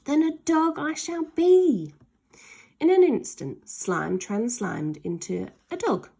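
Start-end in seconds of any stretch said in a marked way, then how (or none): 0.0-1.9 high, loud, determined creature voice; Slime's bold voice. Acted. Slime transforming, loud and high. 2.8-3.7 excited narration. A narrator's reading.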